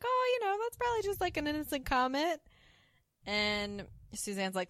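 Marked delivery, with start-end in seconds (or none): 0.0-2.4 much higher, sing-song reassurance; soothing Mary Jo. Retold or imitated. Mary Jo calming a worried friend, high and sing-song. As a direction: brush it off lightly, way up high. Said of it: "And Mary Jo's like".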